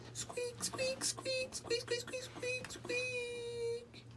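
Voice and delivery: very high, soft squeaking noise; squeaky cat flap. Acted. Narrator imitating the cat flap, very high squeaks. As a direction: squeak like a swinging hinge. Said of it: "the sound of the cat flap swinging".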